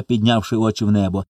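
ordinary delivery, nothing marked

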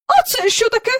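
high alarmed question; startled high question. Acted. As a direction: jump up and ask in alarm. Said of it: "підхопилася місіс Бенкс".

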